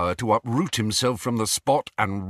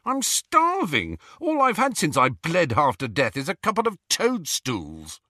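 angry complaining; 7 semitones higher than usual. A narrator's reading. Ron complaining angrily.

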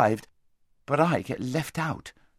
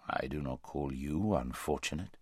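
large deep voice; 8 semitones lower, 8 dB quieter than usual. A narrator's reading. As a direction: speak low and vast, unhurried. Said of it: "said the large voice".

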